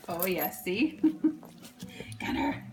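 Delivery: ordinary talk, nothing marked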